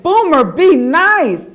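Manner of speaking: loud, high and slow scolding cry; screaming scold. Acted. The twins shout at Boomer after sand in their hair, loud and slow. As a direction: drag it out, loud and upset. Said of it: "The girls screamed and cried".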